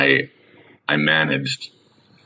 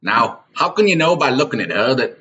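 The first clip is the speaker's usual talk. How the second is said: higher put-on yokel voice; hammed-up fair stall boss; mock manager; 7 semitones higher than usual. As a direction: challenge him like a busybody. Improvised Renaissance fair scene.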